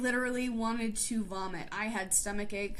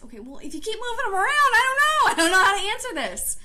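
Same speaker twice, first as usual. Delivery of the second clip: much higher, much louder and fast, panicking; flustered child; 9 semitones higher, 9 dB louder, faster than usual. Retold or imitated. First grader answering the looming teacher, loud panicked blurt. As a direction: blurt it out like a cornered little kid.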